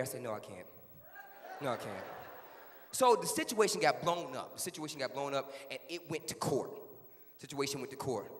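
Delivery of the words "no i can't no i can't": low, soft answer; reluctant refusal. Acted. Child won't tell police about mom, low and quiet. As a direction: keep it low and small.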